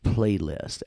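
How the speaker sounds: usual speaking voice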